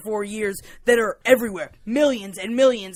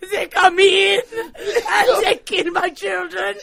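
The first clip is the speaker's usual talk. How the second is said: high, slow, laughing mock wail; mock wailing woman; 9 semitones higher, 4 dB louder, slower than usual. Retelling the woman whose mice ate her pigs, high hammed-up wail. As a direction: ham up a distraught farm woman through laughter. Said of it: "thinking of the Mauschwitz".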